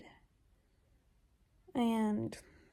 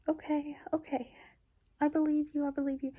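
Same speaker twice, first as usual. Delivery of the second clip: small, higher, hushed voice; lightly voiced scared girl giving in; mildly anxious agreement; 3 semitones higher than usual. Retold kidnapped girl gives in.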